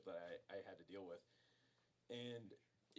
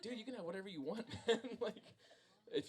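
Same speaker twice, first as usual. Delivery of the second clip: high, fast, half-laughing "take it all"; exasperated; 12 semitones higher, 6 dB louder, faster than usual. Retold or imitated. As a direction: toss it off with amused exasperation. Friends asking for his stuff.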